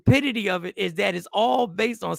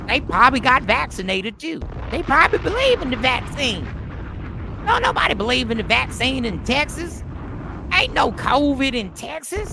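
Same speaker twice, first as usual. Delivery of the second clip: raised-voice mock country accent; bad country accent; 3 semitones higher, 3 dB louder than usual. Texan dismissing the vaccine, exaggerated country accent. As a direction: scoff like a hammed-up Southern country skeptic. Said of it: "That is the worst country accent ever".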